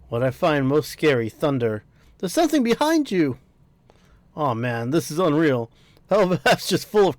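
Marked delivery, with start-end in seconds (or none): 2.2-3.4 alarmed warning. Acted. Voicing a warning line in-game.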